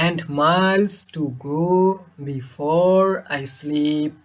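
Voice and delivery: very slow, "miles", "go", "before", "sleep" stretched long; short "and", long "miles", short "to", long "go", and so on to "sleep"; iambic reading. Shown as a demonstration. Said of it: "চতুর্থ লাইনে একই line". ("miles", "go", "before", "sleep" টান দিয়ে)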